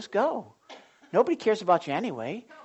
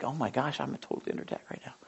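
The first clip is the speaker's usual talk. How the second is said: fast, unvoiced hush; hushed realization; 5 semitones lower, 11 dB quieter, faster than usual. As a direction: whisper it quickly in alarm. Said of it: "And I'm like".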